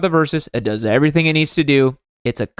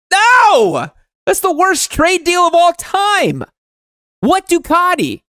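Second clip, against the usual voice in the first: high, loud cry of disbelief; outraged shout; 4 dB louder, slower than usual. Real, not acted. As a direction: yell it like a betrayal. Outraged at trading a Ducati.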